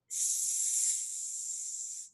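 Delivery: long soft sibilant hiss; snake hiss. Shown as a demonstration. Teacher showing the child a siseo, snake hiss. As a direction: let out a sustained snake hiss. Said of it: "Es como un siseo".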